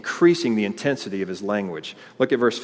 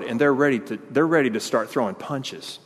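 normal talking voice

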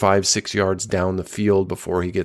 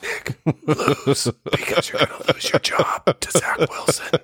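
joining in the mock coach whisper; whispered taunt to the quarterback; Kyle Shanahan whispering; 7 semitones higher than usual. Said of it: "being a quarterback whisperer to Nick Mullens".

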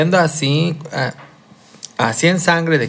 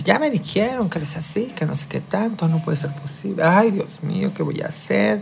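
distraught mother; 5 semitones higher, 6 dB quieter than usual. Retold or imitated. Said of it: "estaba fúrica ella".

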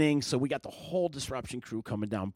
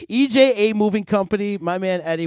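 excited; 7 semitones higher, 9 dB louder than usual. Real, not acted. Hyped sponsor shout-out.

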